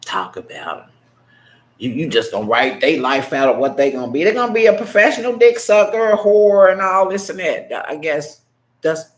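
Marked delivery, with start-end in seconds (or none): 1.8-7.7 fast, much louder, sneering mimicry of cruel adults; angry mocking outburst. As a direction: spit out their words back at them, fast and loud. Mocking cruel adults' words about kids.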